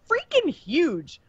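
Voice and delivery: awed high exclaim; amazed. Real, not acted. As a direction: marvel at how huge he is. Awe at Monkey King's size.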